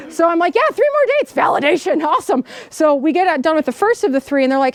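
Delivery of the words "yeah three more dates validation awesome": mock excited. Retelling own eager reply.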